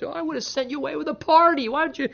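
high, quick mock-offended father-in-law; mock Laban complaint. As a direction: play Laban as a wounded, fussy father. Preacher voicing Laban's complaint.

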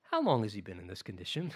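lightly voiced calm Jesus; calm, gentle question. A narrator's reading. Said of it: "keeping the situation peaceful and calm".

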